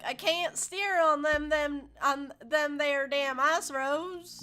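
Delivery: loud, high Southern drawl, slowed down; hammed-up Southern trucker accent. Trucker brother on the haunted ice road, hammed-up Southern accent. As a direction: play a panicked Southern trucker losing control of his rig. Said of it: "I am gonna do an accent for this".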